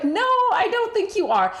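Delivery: high, drawn-up doubtful "no"; sceptical challenge. Retold or imitated. Woman telling her match he's not Irish, high sceptical challenge. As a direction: contradict him in a high, doubting voice.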